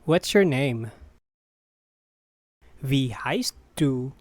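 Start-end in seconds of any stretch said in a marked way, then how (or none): 2.8-4.1 careful teaching pace. Shown as a demonstration. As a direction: separate the words for a learner. Teacher repeats slowly for learners.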